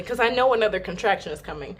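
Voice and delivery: usual speaking voice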